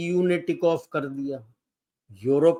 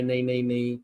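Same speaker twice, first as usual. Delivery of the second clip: mock New Zealand denial; 5 semitones lower than usual. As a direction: lightly echo the same flustered refusal. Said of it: "अपना New Zealand भी बोल रहा है".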